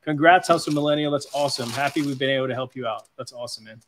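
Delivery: ordinary delivery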